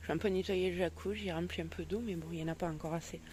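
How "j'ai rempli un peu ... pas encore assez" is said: usual speaking voice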